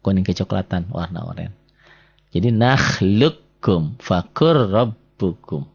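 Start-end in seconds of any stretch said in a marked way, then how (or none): 2.5-4.0 slow recited "nakhluqkum", q lost in a held k; silent merged q. Shown as a demonstration. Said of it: "orennya jangan dibaca". 4.0-5.7 drawn-out "fakur rabbukum" joined on a held r; merged double r. Shown as a demonstration. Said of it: "orennya jangan dibaca".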